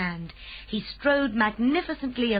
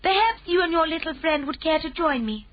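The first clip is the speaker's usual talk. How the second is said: a lightly voiced calm Queen, higher; gracious royal command, a touch higher; 8 semitones higher, 4 dB louder than usual. A narrator's reading. Queen speaks.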